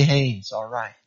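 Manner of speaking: put-on comforting a friend with a crying baby, softly; soft soothing. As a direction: settle them down gently. Soothing a tired new parent.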